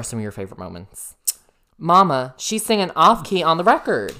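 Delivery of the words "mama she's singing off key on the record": excited outburst. Real, not acted. Excited gossip about Whitney.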